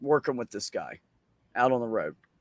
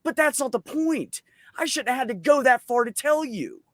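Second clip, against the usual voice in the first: high-pitched retold telling-off; indignant reproach; 9 semitones higher than usual. Telling off the coworker who should have respected them, indignant. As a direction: plead the point insistently, pitched up.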